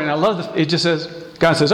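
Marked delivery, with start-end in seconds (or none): none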